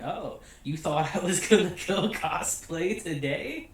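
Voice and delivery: giggling through a teasing question; laughing tease. Retold or imitated. Marin teasing Gojo about the cosplay day, laughing. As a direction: tease him, laughing at the mix-up.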